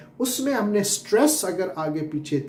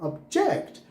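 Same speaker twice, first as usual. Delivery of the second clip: Object said ob-JECT, raised second syllable; stress on second syllable; 13 semitones higher than usual. Shown as a demonstration. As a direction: hit the 'ject' in object. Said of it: "अगर दूसरे syllable पे जोर दिया". (Object के दूसरे syllable पर ज़ोर)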